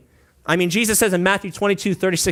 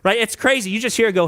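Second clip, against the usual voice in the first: ordinary talk, nothing marked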